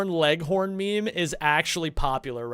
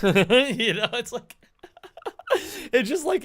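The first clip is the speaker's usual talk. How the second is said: laughing; 6 semitones higher than usual. Real, not acted. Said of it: "laughing after own impression".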